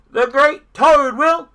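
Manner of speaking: high, loud announcement cut short; stage announcer. A narrator's reading. The voice starts introducing Toad, loud announcer. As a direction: announce grandly until interrupted. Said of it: "The voice said".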